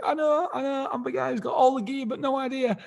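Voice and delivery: mock sheepish self. Admitting the mistake to the host, high and sheepish. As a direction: own up in a squeaky put-on voice. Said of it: "I'm like".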